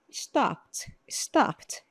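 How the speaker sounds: stopped ending in a crisp T; final T sound. Shown as a demonstration. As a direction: say stopped as one syllable closing on T. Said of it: "esse final aí tem o som de tipo um T".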